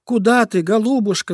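calling after. Acted. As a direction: call out to the crow flying away. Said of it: "закричала Лиска Лариска ей вслед".

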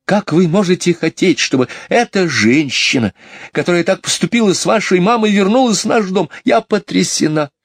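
put-on high, shocked scolding of the children; indignant mother. Mother scolds children over the nanny, high and indignant.

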